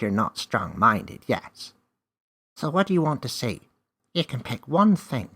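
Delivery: small wise creature explaining the rules; magical helper creature. Acted. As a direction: play a confident little magical guide. Magical creature Name speaks.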